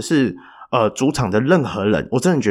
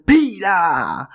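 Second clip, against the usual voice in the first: a mild mock yell, a bit higher; retold shout; 7 semitones higher than usual. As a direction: player yelling at the ref after the whistle, mild mock yell. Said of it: "他喊了一大声，很大声".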